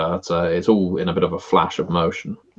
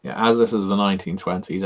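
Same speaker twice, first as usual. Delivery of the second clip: ordinary talk, nothing marked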